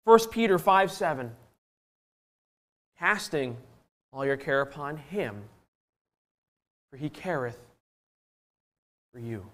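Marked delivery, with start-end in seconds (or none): 3.0-9.5 hushed scripture quote. Real, not acted. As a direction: recite it gently, a phrase at a time.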